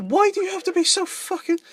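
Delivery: very high, squealed retold complaint; exasperated mock protest. Retelling his protest to the officers.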